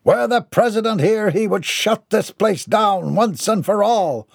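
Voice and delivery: pompous, high-pitched complaint; indignant Mortimer. A narrator's reading. Mortimer berates the postman over his facility, indignant. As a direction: demand answers in grand outrage.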